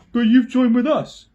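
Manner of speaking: high, loud animal voice; badger protesting. A narrator's reading. Truffle Hunter protests.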